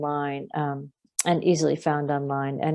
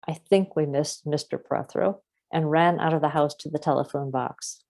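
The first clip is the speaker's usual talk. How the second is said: plain reading voice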